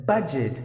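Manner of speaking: budget with a clear u as in cup; "budget" spoken slowly and cleanly, BUD-jit. Shown as a demonstration. Teacher modelling the word "budget" for learners, careful and clear. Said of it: "that's how you gotta pronounce the word".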